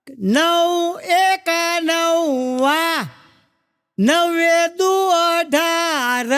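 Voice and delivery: very slow sing-song times-table chant; teacher reciting tables. Retold or imitated. Headmaster reciting tables to first graders, very slow chant. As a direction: drag out each number like a classroom recital, "નવે છકું એંસી" drawn out with a falling end. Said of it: "સાહેબ ભણાવતા હતા".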